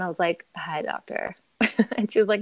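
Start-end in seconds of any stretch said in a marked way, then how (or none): 0.5-1.3 flat, quiet, resigned greeting; monotone. Retold or imitated. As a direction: say it flat, expecting bad news. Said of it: "like, very monotone".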